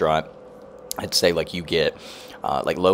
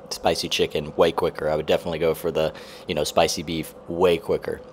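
ordinary delivery

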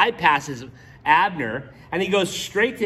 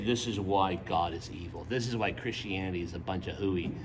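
mock critic; 11 semitones lower, 9 dB quieter than usual. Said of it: "and say".

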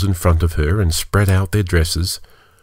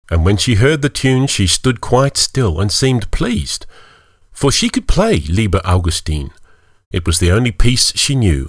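plain reading voice

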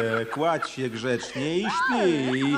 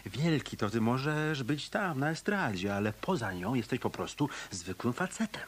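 hushed, threatening whisper; menacing whisper; 4 semitones lower, 7 dB quieter than usual. Acted. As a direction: whisper it right in his face. Detective threatens musician quietly.

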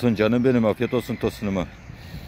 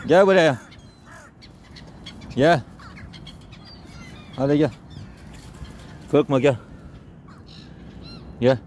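coaxing animals; 6 semitones higher than usual. Real, not acted. Feeding timid strays, calling them over gently, high sing-song coaxing. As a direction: coax a shy stray dog to come close.

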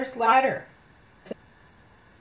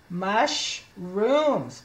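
Mushrooms split slowly into mush and rooms; sounding out. Shown as a demonstration. Teacher decoding for children, Mushrooms slowly sounded out in two parts. Sounds out word, then blends it.

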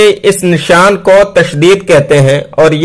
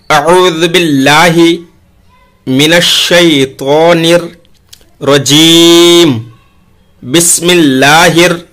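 slow, drawn-out chanted recitation; Quranic recitation; much slower than usual. Acted. Teacher opens the lesson with recitation, slow chant. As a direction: recite it slowly and reverently.

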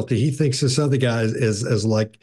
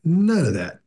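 ordinary talk, nothing marked

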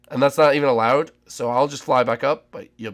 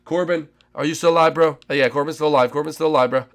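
ordinary talk, nothing marked

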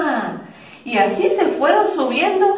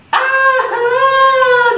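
exclaiming; 13 semitones higher, 6 dB louder than usual. Acted. Jealous number two interrupts.

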